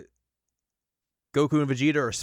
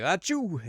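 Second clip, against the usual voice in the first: mock sneeze; 8 semitones higher than usual. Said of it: "He's like".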